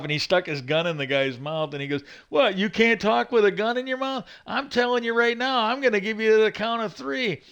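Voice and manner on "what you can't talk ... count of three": high, hammed-up sneering tough cop; mock cop taunt. Cop counting down on a suspect, high mock sneer. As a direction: taunt him like a movie cop with a gun.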